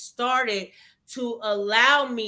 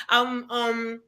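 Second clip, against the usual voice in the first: usual speaking voice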